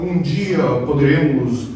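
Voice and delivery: a lightly voiced stuffy young man; mock formal speech. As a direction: parody of churchy formality. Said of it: "um rapaz vai falar".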